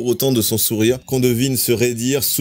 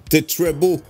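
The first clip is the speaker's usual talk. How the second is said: put-on British accent in French; 3 semitones higher, slower than usual. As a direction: say it with an English lilt. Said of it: "T'as fait un accent".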